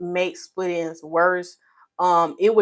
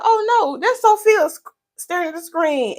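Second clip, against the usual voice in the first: excited exclaim; 8 semitones higher than usual. Retold or imitated. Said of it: "I said".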